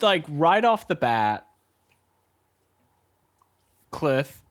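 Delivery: ordinary talk, nothing marked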